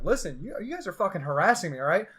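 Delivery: retold indignant rant; going after him. Student confronting trip coordinators over harassment, acted angry rant. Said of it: "I just start going after him".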